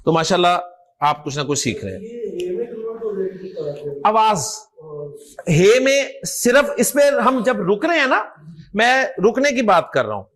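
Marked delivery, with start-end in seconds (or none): none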